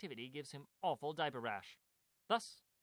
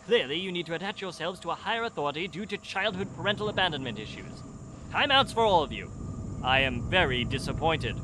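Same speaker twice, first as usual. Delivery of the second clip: loud, lofty know-it-all; pompous detective; 5 semitones higher, 13 dB louder than usual. Acted. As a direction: lecture them like a smug genius. Detective character scene.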